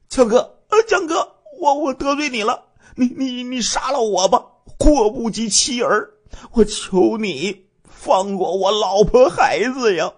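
high, stammering plea for mercy; terrified begging. Acted. Man on his knees begging the gunman to spare his family, terrified and high. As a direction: beg for your life, voice climbing high. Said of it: "吓得呀，赶紧下跪求饶啊".

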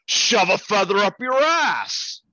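loud, high-pitched blurted yell; drunken shout. Acted. Drunk man shouting at the redhead, high loud yell. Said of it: "She turns around and he goes".

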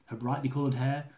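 ordinary talk

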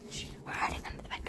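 fast hushed whisper; quick secret whisper; whispering while hiding. Real, not acted. Hiding during hide and seek.

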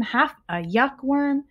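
usual speaking voice

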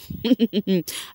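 short, closed chuckle; disbelieving chuckle. Real, not acted. Vlogger doubting Venita will ignore it, disbelieving chuckle. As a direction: chuckle as if you can't believe it.

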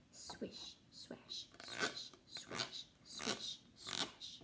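breathy whispered rhythm; whispered swish swash. Acted. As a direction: whisper the grass noises softly. Whispered grass sound words.

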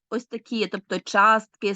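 ordinary talk, nothing marked

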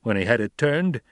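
plain reading voice

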